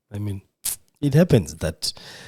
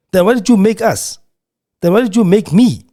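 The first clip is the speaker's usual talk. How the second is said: raised, higher pleading question; anguished plea; 8 semitones higher, 4 dB louder than usual. Real, not acted. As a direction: cry out the question at God. Angry plea to God.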